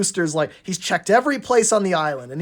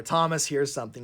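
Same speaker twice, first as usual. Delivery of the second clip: usual speaking voice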